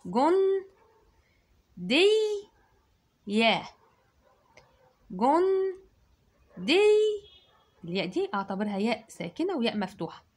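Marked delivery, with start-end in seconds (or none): 0.1-6.8 جن دي called high and loud; high loud syllables. Shown as a demonstration. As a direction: call out each syllable. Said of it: "نكتبها ازاي". (جن دي بصوت عالي)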